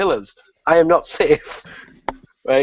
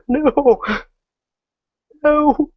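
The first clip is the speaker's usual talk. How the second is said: much higher, anguished cry of "No!"; mock despair; 13 semitones higher, 3 dB louder than usual. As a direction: wail it as the hit lands. Gamer watching himself get killed.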